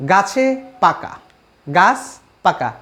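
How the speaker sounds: slow, with a pause between গাছ and পাকা; word by word. Shown as a demonstration. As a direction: draw out গাছে পাকা, then separate each word. Teacher repeats example word by word.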